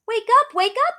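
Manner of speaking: high, loud shout to wake them. Acted. Beauty waking her sleeping parents, high loud shout. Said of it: "I shouted".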